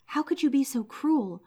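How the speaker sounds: put-on the old man breaks out in anguish; distressed outcry. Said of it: "he was terribly distressed".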